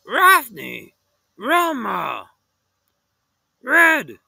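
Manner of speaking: loud high calls in a dog voice; Scooby calling to his friends. Acted. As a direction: bark out each name.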